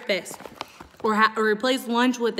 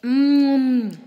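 savoring mm; 3 semitones higher, 6 dB louder than usual. Real, not acted. Savoring a bite of popcorn.

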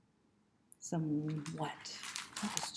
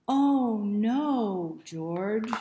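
loud sing-song exclamation; exclaiming; 3 semitones higher, 10 dB louder, much slower than usual. Shown as a demonstration. Reader leading children in the refrain, exclaiming loudly. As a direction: call it out big for the kids. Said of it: "We're gonna go".

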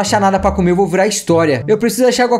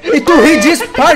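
laughing protest; 7 semitones higher, 4 dB louder than usual. Real, not acted. Reclama que Tisha ri.

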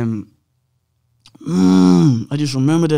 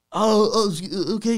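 flustered nurse; 8 semitones higher than usual. Retold or imitated. Nurse taken aback.

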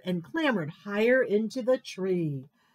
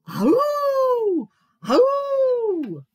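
high, loud drawn-out howl; awful howl; 8 dB louder, much slower than usual. A narrator's reading. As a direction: howl long, falling at the end. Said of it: "this awful ah-oo".

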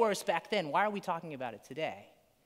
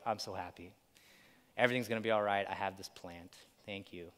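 lower, much softer blissful sigh of relief; contented Jonah; 8 semitones lower, 5 dB quieter, faster than usual. Retold or imitated. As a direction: sink into mock contentment. Jonah voiced happy over plant.

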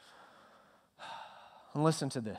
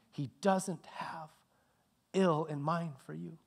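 slow, whispered words; 3 semitones higher, 3 dB quieter, slower than usual. Real, not acted. Preacher comforting the church, slow whisper. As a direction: whisper it slowly and gently.

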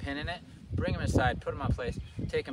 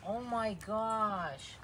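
"Oh my gosh" high and very slow, "my" stretched; gushing oh my gosh; 10 semitones higher, much slower than usual. Real, not acted. Owner gushes "Oh my gosh" at her puppy, high and slow. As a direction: drawl "Oh my gosh" up high, melting over the dog.

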